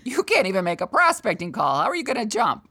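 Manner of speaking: lightly voiced taunting inner voice; inner critic voice. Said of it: "My little voice".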